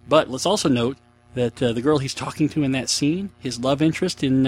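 ordinary delivery

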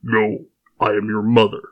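a hint of the Sith lord's gravity; lightly voiced Darth Vader. Host reading a listener's joke as Vader. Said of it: "much better".

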